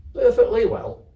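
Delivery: put-on high, loud little girl answer; proud child. Kezia assuring her grandmother proudly, high and loud. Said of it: "Kezia answering grandmother".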